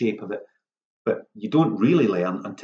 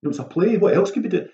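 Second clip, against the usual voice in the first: imagined sceptic; 7 semitones higher than usual. Pretend. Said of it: "you might think".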